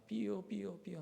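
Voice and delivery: high mock siren wail; softly voiced siren sound; siren imitation. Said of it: "하면서".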